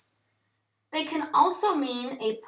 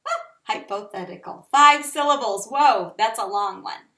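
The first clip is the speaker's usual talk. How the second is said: ordinary delivery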